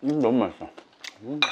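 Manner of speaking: talking while chewing eel; muffled hum between bites; mouth full, chewing. Real, not acted. Seen 3: Mouth closed, cheeks working after eel bites just before.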